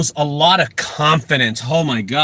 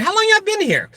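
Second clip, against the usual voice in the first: pitched way up, curious and nosy; high nosy question; 14 semitones higher than usual. Retold or imitated. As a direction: ask it high and chirpy, just being nosy.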